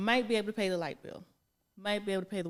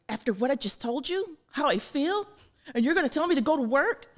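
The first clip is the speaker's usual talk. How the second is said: high, loud mock indignation; mock outrage; 7 semitones higher, 10 dB louder than usual. Imitating her ex complaining about work, high and loud mock outrage. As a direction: throw up your hands in outrage.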